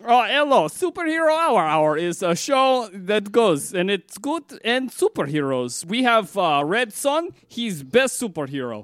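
put-on slow, broken-English foreign accent; foreign show host. Foreign guest plugging a superhero show, slow accented sales pitch.

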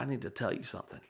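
hushed, imitating a small boy owning up; tearful confession. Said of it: "tears in his eyes".

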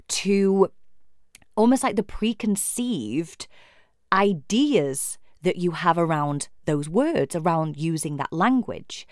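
usual speaking voice

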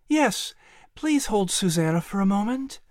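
a tired mother asking kindly; gentle mother. Acted. Mother hands over toddler.

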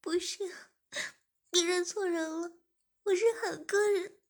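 high-pitched tearful denial; crying protest. Acted. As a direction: deny it through tears, pitched high. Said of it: "志敏流着泪说".

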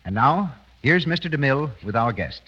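usual speaking voice

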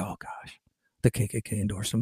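whispered fake shock, mocking them; mock horrified whisper. Mocking the leftists' claim, a fake-shocked whisper. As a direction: hush it like a scandalous secret.

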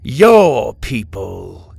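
YOUR drawn out slow and loud; pointed correction. A narrator's reading. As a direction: lean long and hard on "Your" to correct him. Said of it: "Kai corrected".

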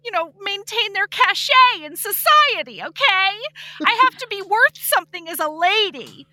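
high, prissy society lady; haughty lady voice. Retold or imitated. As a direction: look down on him like a fussy lady. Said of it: "And she's like".

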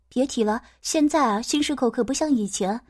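ordinary delivery, nothing marked

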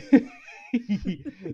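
loud amused laugh; burst of laughter. Real, not acted. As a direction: laugh out at yourself. Laughing at own accent.

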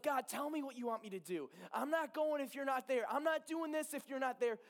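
high, rising retold plea to God; insistent demand. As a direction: insist to God, pitch climbing line by line. Retelling his insistent demands to God.

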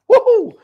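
loud, high "woohoo" cheer; excited whoop. Real, not acted. Cheering the lovely Ing.

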